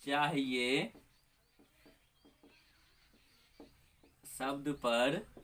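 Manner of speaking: slow teacher dictation, word by word. Shown as a demonstration. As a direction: spell it out slowly for note-taking. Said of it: "ये क्या होता है?".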